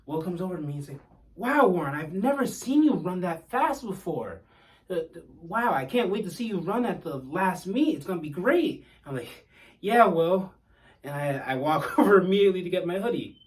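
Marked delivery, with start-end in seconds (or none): 1.3-8.8 higher, louder amazed teammate voice; impressed teammate. Retold or imitated. Teammate Will praising him. 9.8-10.5 higher, louder cheerful brush-off; excited reply. Retold or imitated. Replying to Will, pumped.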